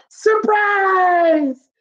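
mock yell. A narrator's reading. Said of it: "the yell".

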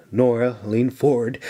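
ordinary delivery

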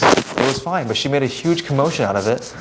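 normal talking voice

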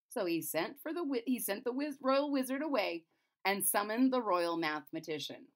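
usual speaking voice

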